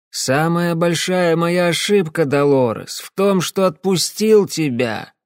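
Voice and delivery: high, slow, self-pitying whine; tearful whining. Acted. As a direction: drunk and weepy, pleading with his ex. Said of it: "слезливо гнусавил он".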